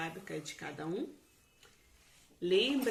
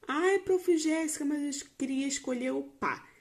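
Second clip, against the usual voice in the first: put-on higher, louder child's objection; mock pupil complaint, pitched up and loud; protesting pupil; 6 semitones higher, 6 dB louder than usual. Teacher voices a pupil's objection.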